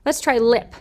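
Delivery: normal talking voice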